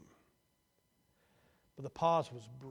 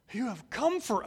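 joyful shout of reunion; 9 semitones higher, 9 dB louder than usual. A narrator's reading. Youngest brother shouting to eldest, loud and high. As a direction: call out loud and high with relief. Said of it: "he shouted".

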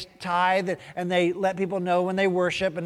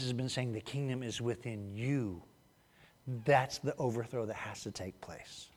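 soft unvoiced whisper; 7 semitones lower, 11 dB quieter than usual. Real, not acted. Preacher whispering the point to the congregation. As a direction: breathe it like a secret.

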